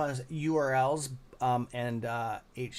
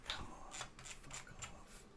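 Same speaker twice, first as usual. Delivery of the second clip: slow, voiceless muttered curse; slow exasperated whisper; 18 dB quieter, much slower than usual. Real, not acted. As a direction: hiss it at the screen under your breath. Frustrated at blocked upload.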